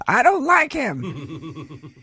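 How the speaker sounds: lightly voiced child, high and slow; hint of a small kid's complaint; child's voice. Host retells the son, lightly voiced child. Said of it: "your kid came out ... and said".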